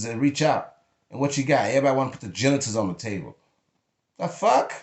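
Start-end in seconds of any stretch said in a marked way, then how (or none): 4.2-4.7 sharp high disbelief; indignant disbelief. Real, not acted. Reacting to people's demands, high indignant. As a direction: spit out the disbelief.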